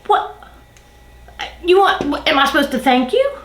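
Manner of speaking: mildly indignant questioning; incredulous. Retold or imitated. Employee reacting to boss's boastful text, incredulous. Reacting to boss expecting thanks.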